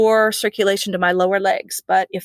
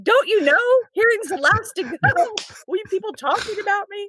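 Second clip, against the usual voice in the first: much higher, incredulous inner voice; mock indignant thought; 12 semitones higher than usual. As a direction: play the outraged patient who hears everything.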